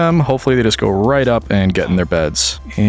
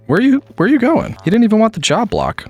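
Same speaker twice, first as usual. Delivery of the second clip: high, incredulous question; can't believe the villager left; baffled; 12 semitones higher than usual. Real, not acted. Gamer baffled at a wandering villager, high incredulous.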